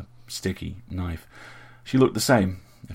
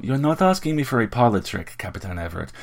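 louder, higher, exasperated scolding; an exasperated sorceress demanding a straight answer, louder and higher; huffy; 6 semitones higher, 6 dB louder than usual. A narrator's reading. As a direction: huff at him and press for an answer. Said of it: "she huffed and went on".

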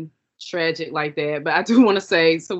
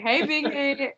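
higher, playful greeting; catchphrase; 6 semitones higher, much slower than usual. Retold or imitated. Friend echoing the show's greeting, higher and playful. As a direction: call it out like the character. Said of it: "echoing the show".

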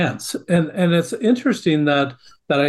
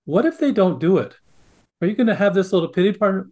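ordinary talk

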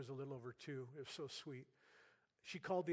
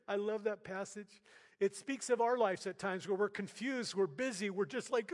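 louder, higher preaching build; rising preaching; 6 semitones higher, 7 dB louder than usual. Real, not acted. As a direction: swell into full preaching fervour. Preaching ramps up.

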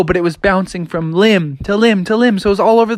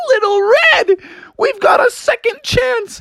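high overjoyed cub voices. Acted. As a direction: squeal with hammed-up joy. Bears overjoyed at second chance.

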